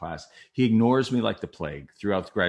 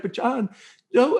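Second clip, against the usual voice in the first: high, choked-up protest; tight-throated; 14 semitones higher, 5 dB louder than usual. Retold or imitated. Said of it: "your throat gets tight".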